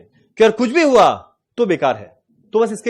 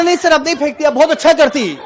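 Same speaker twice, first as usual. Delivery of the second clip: high, louder mock South Indian Hindi; mocking his accent; 7 semitones higher, 5 dB louder than usual. Trainee mocks South Indian supervisor's Hindi back at him. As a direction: copy his feminine verb endings back at him. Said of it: "उसका tone का मजाक उड़ा रहा है".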